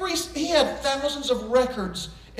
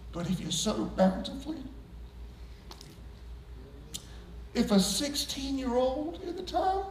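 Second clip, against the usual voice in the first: preaching close; 8 dB quieter than usual. Real, not acted. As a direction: draw the congregation in quietly.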